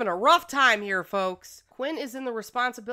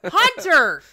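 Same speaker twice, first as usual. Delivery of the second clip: calling out, fed up and pleading; exasperated shout; 6 semitones higher, 9 dB louder than usual. Real, not acted. Streamer yelling at sims to group up, exasperated shout.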